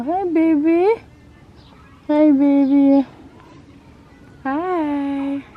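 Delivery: high, loud coo to the horse. Real, not acted. Cooing at the horse.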